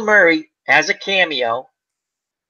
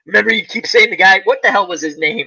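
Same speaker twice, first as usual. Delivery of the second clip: usual speaking voice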